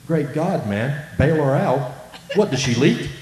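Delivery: loud, high, acted disbelief; astonished outburst. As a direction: exclaim it incredulously, building to the punchline. Acted astonished punchline of the old islander.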